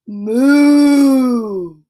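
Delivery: loud bellowing moo; bellowed moo. Acted. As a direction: bellow it like a cow. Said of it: "and he bellowed".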